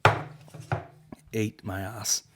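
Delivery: usual speaking voice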